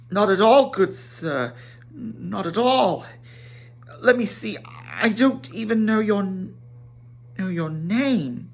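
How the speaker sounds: high, fussy, hurried hobbit; flustered Bilbo. Acted. Bilbo flustered before the wizard, high and fussy. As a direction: stammer out a polite excuse.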